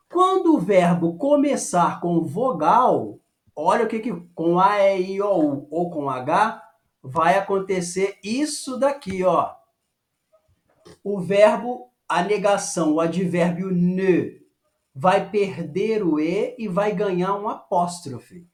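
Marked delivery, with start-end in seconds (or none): none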